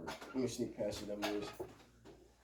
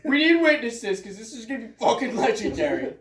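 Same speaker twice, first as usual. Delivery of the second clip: high, loud hype bro; hyped bro calling for witnesses; gym bro; 9 semitones higher, 6 dB louder than usual. Pretend. Joining the imagined duel scene.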